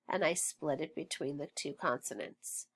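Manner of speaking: ordinary talk, nothing marked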